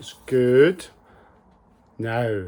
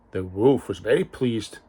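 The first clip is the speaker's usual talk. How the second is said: ordinary delivery, nothing marked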